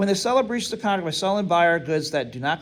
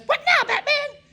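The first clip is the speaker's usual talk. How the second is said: much higher, cartoonish toy voice with a laugh; Robin doll voice. Teacher voicing the Robin doll for the class, high toy voice. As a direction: squeak it out like a talking toy sidekick. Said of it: "and the Batman doll says".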